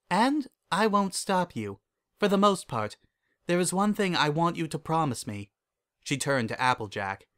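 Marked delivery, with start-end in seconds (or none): 0.1-5.5 higher-pitched princess voice; lifted regal character voice; Celestia, composed princess. Acted. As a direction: speak higher, as a composed royal mentor.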